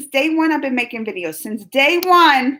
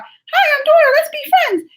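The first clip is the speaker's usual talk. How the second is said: Dora voice; 5 dB louder than usual. Retold or imitated. Vlogger mimics Dora greeting viewers, very high cartoon voice. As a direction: squeak it like the corny cartoon Dora. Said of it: "This crazy girl here talking about".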